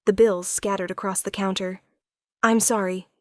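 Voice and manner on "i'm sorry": usual speaking voice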